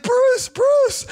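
very high, urgent call of a name; calling urgently. Retold or imitated. Calling to his friend on the floor, urgent high call.

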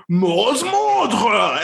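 put-on guttural French accent, pitched high; mock a villain's bizarre guttural French; weirdly French accent. Podcaster mimicking Junior's spell, French accent. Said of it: "it's, like, weirdly French".

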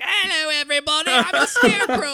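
high, squeaky fake British voice, laughing through it; hammed-up British villain impression; mock British accent. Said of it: "doesn't he have, like, a weird British accent too?".

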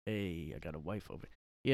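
low-pitched goofy Italian comic impression; Sebastian Maniscalco. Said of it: "'Cause he's Italian".

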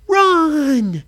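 loud high yell; shout. A narrator's reading. Kids fleeing the pirate, shouting. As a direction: scream it to flee.

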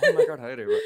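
high squealing laugh through words. Real, not acted. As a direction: squeal it out giggling. Greeting a listener, laughing.

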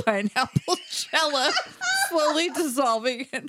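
breaking into giggles; laughing. Real, not acted. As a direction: read it while cracking up. Reader cracks up at the end.

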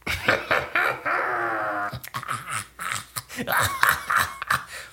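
long, high-pitched creepy cackle; Gollum-like cackle. Real, not acted. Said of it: "That was Gollum or something".

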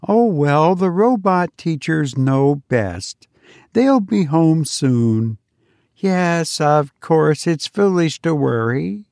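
a mother talking herself out of worry; worried parent voice. Acted.

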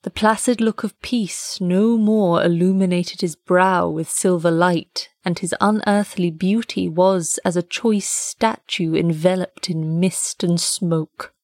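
usual speaking voice

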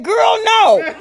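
high, loud, sassy refusal; flesh refusing. Acted. Her flesh refusing to be saved, a high, loud, sassy no. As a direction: slam the door on it, bossy and loud. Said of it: "My flesh said".

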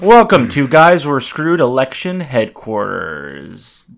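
slow, loud announcer intro. Acted. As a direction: boom it out like a show host.

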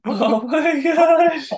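high, drawn-out squeal of dismay; long, high-pitched astonished reaction; shocked disbelief. Real, not acted. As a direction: stretch it out high in mock horror. Reacts to the sandwich reveal.

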